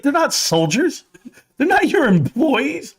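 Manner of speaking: high, slow mock wife, stressing not; stern wife. Wife telling husband kids aren't employees, high and slow. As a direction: lecture him like his wife, slowly. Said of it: "She's like".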